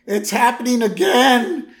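high, loud mock panic; panicked Barney. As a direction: freak out in horror. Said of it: "He's like".

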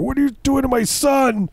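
very high mock-angry father voice; angry dad. Father confronting the stranger, high hammed-up demand. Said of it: "The dad comes around the corner".

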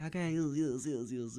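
high, placating babble of agreement; mock-meek, squeaky string of yeses; passive appeasing. Comic acting himself placating an angry driver, high meek babble. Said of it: "passive, passively".

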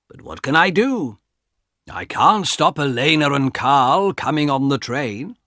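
put-on the old guard telling what he knows; calm guard voice. Guard explains ghosts.

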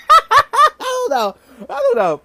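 high, loud and slow, squealing with laughter; laughing. Real, not acted. Vlogger cracks up at the TV joke, squealing laugh.

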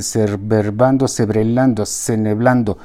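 put-on "cerverbando", "cebrelando", "ceneblando" fumbled drunkenly for celebrando; drunken mispronunciation. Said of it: "repitiendo varias veces la palabra".

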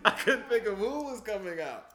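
loud laughing, high-pitched. Real, not acted. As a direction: say it through real laughter. Cracking up over a joke.

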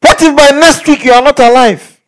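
loud and high, a dire warning; loud threat. Retold or imitated. Preacher re-enacting his threat to the guy, loud and high. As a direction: hammer the warning home.

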